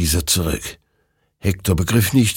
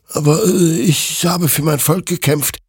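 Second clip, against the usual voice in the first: higher, hesitant excuse; a soldier pleading his case; justifying; 10 semitones higher, 3 dB louder than usual. A narrator's reading. Hector justifying himself to the creature, hesitant. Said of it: "rechtfertigte sich Hector".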